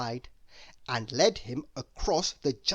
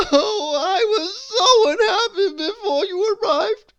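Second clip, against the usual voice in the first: high, loud wail; 11 dB louder than usual. A narrator's reading. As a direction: wail it in misery. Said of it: "he wailed".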